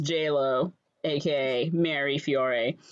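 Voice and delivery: normal talking voice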